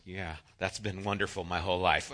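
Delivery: low, quiet dry sarcasm; deadpan it, low and dry; sarcastic. Retold or imitated. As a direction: twin answering, quiet and sarcastic. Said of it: "And it's like".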